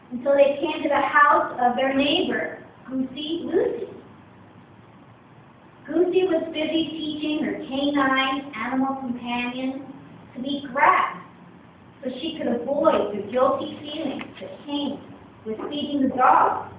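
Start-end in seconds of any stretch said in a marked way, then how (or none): none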